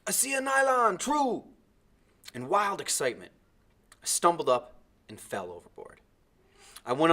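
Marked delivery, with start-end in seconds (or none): 0.1-1.4 high, loud excited call; excited shout. A narrator's reading. Timothy spots an island from the raft, excited shout. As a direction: shout out the sighting of land. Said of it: "I heard Timothy shout".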